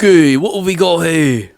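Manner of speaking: hammed-up Aussie adventurer; Australian accent. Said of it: "pretty good at accents".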